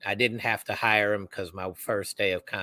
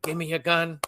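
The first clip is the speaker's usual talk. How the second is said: quick higher-pitched retold demand; urgent demand; 8 semitones higher than usual. Retold urgent demand while running past.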